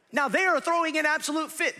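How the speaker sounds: high, loud, indignant preacher; full-force preaching. Real, not acted. Preacher telling of kids' tantrum, full-force delivery. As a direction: preach it at a near shout.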